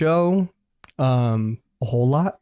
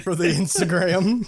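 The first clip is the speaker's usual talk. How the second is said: laughing echo; 3 semitones higher, slower than usual. Real, not acted. Mocking echo through laughter.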